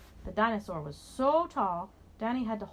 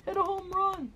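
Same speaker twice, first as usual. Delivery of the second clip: dinosaur cheering; 12 semitones higher, 4 dB louder than usual. A narrator's reading. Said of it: "said the dinosaur".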